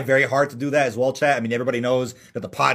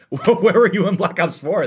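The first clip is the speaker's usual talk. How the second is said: laughing; 6 semitones higher, 5 dB louder, slower than usual. Real, not acted. Laughing jab at latecomers.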